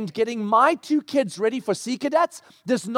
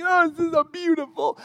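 high-pitched pretend bliss; mock gushing delight; 8 semitones higher than usual. Couple pretending on date night, mock gushing. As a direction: coo like carefree newlyweds.

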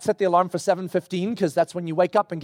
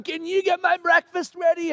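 mock calling down; 9 semitones higher than usual. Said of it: "my daughter shouts down".

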